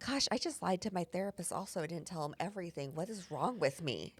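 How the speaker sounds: sinking into a quiet self-scolding murmur; inner thought, hushed. Retold or imitated. Owner quietly scolding herself about lying to therapist. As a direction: think it to yourself, ashamed. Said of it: "and then I think".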